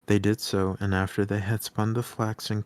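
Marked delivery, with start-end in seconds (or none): none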